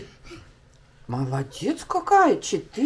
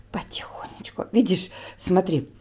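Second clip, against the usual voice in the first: whispering; 3 semitones lower, 5 dB quieter than usual. Real, not acted. Calming crying son over homework.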